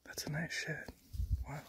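soft hushed whisper. Real, not acted. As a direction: breathe it in quiet awe. Hushed delight at a find.

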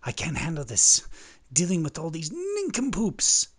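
loud exasperated rant, rising high; agitated king. Acted. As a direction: let the frustration burst out on the insult. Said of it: "Gets me so agitated".

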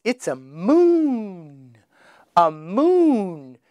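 "moon" drawn out very slowly; slow model word. Shown as a demonstration. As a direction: stretch the word "moon" long and slow for a child. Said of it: "You say moon".

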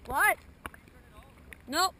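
shouting insults. Real, not acted.

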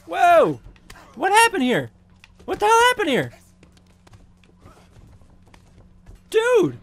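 very high, loud outcry; shocked yelling. Real, not acted. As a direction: scream at the screen in disbelief. Shocked at fight turn.

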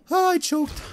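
very high, falling wail; dismayed cry. Real, not acted. Player to himself after choking, dismayed. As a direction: cry out at your own blunder. Player just blew the round.

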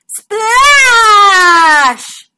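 shouted splash noise, high and loud. A narrator's reading. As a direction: belt out the splash. Sound effect in story.

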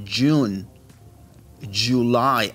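"June" y "July" con j inglesa, lentos; j inglesa marcada. Shown as a demonstration. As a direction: marca el sonido "dch" al inicio de "June" y "July". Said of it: "Ahí sí se escucha ese G". ("June" y "July" empezando con "dch")

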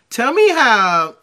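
slow, loud mock of her cackling laugh; mocking a psycho laugh. Host mimicking the woman's crazy laugh, slow and loud. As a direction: drag out the words, then cackle like the clip. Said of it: "You hear that laugh?".

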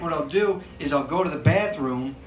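usual speaking voice

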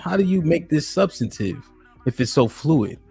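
ordinary talk

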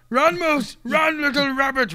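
high, loud urging; urgent cheering-on. Real, not acted. Gamer urging teammate to escape, high urgent call.

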